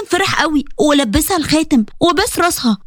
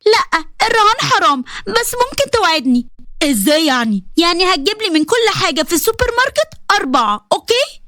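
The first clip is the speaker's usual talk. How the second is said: put-on teasing girl. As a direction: tease him like a young girl. Young Bushra bargaining.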